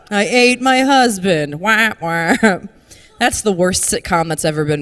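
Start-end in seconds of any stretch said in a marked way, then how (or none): 0.0-2.3 slow, hammed-up zombie bride's wail; comic zombie lament; zombie bachelorette. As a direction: drag it out like a mock-weeping undead bachelorette. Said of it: "the zombie bachelorette party in the corner".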